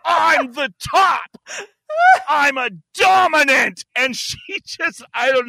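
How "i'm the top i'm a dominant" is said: high, drawn-out indignant shout; hammed-up wail with a swab jammed up the nose; protesting through pain. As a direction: yell it slowly, in mock agony. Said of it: "with this thing jabbed into my brain".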